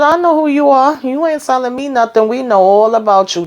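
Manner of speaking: high, heated telling-off; acted confrontation. Cult leader confronting Richard alone, acted heated challenge. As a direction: launch in high and come down gradually.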